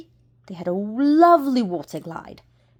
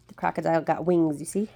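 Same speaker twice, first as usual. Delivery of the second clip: usual speaking voice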